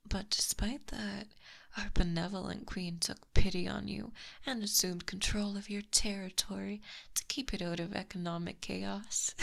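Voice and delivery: usual speaking voice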